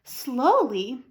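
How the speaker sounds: usual speaking voice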